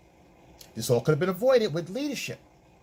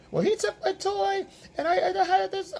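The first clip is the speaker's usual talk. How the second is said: put-on much higher child's complaining voice; whiny kid. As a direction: play a little kid tattling on a sibling. Child voice in family scene.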